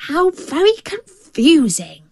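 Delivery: high, bemused jackal voice; storybook jackal, all at sea; puzzled jackal. A narrator's reading. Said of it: "said the jackal".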